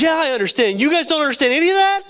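high-pitched incredulous outburst, retold; astonished disbelief. Weeping Adam to his praying friends, astonished disbelief. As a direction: act out a friend's stunned disbelief, voice shooting up.

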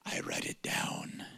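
hushed whisper in Mike's voice; whispered impression. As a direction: whisper it low as a sacred secret. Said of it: "I'm not gonna do voice impersonations".

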